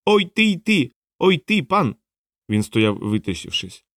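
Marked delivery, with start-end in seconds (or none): 0.0-2.0 high, piping little voice; small creature's cry. A narrator's reading. Little animal hopping up to the stranger, high small cries. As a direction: squeal like a tiny startled creature. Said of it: "тихенько скрикнула".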